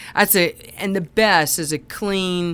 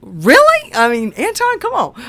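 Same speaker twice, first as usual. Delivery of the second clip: high, loud mock disbelief; incredulous; 10 semitones higher, 7 dB louder than usual. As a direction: leap up in pitch, protesting in disbelief. Acted incredulous reply to student.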